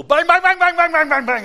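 high, loud rapid-fire bangs; imitated gunfire. Officer emptying pistol into the sky, imitated loud gunfire. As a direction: rattle off pistol shots at full voice. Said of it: "Like that".

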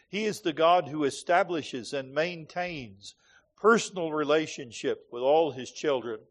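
usual speaking voice

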